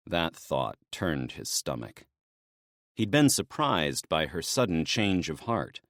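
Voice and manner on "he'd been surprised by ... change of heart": plain reading voice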